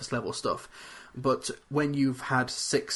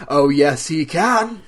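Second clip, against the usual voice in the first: high, loud playful retort; loud teasing put-on voice; mock insistent; 4 semitones higher, 11 dB louder, much slower than usual. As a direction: fire back in a silly voice. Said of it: "But wait a minute".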